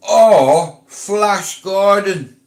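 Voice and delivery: Flash Gordon dragged out slowly and loudly; drawn-out nickname. Real, not acted. Vlogger mocking a rival by his nickname, slow and loud. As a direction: stretch out Or Flash Gordon for effect.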